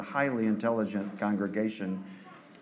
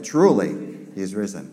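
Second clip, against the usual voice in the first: ordinary talk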